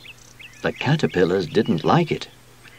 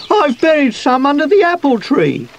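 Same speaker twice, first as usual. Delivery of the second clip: high, loud fretting; worried; 5 dB louder than usual. A narrator's reading. Sweep fears for his buried bones, worried. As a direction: blurt it out anxiously, pitched high. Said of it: "looking worried".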